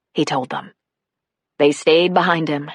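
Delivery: usual speaking voice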